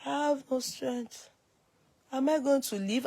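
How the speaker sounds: faint drained murmur; weak faint voice. Retold or imitated. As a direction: speak like all strength is gone. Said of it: "I was just doing like this".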